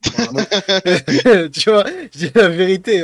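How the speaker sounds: laughing. Real, not acted. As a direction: laugh it off while protesting. Caught laughing, defending himself.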